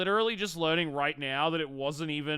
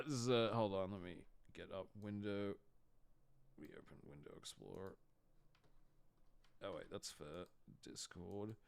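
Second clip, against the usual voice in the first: muttering to self; 9 semitones lower, 18 dB quieter than usual. Real, not acted. Muttering while opening windows.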